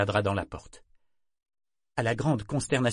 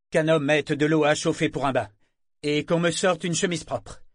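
imperious orders, high and forceful; firm; 7 semitones higher, 5 dB louder than usual. A narrator's reading. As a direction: issue orders as if to a valet. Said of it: "prendre un ton ferme".

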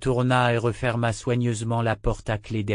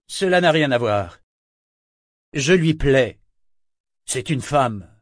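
defensive; 3 semitones higher, 5 dB louder than usual. A narrator's reading. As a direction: justify it defensively. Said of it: "sur la défensive".

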